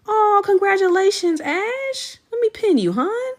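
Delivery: high, loud delighted congratulations; excited squeal. Real, not acted. To pregnant viewer Ash in chat, delighted high squeal. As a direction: squeal with joy for a viewer's news.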